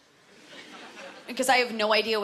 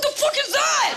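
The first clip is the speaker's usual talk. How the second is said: shocked shriek; 5 dB louder than usual. Retold or imitated. Visiting date spotting the sex machine, shrieking in shock. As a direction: recoil in horror, voice way up. Guest spots the sex horse.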